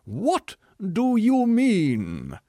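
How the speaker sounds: high, loud and slow; outraged scream. Acted. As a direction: keep shrieking, offended. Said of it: "screamed the tragedian".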